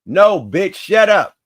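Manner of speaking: loud, high, irritated snap; snapping shut up. Real, not acted. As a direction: cut her off hard. Snapping at the computer voice.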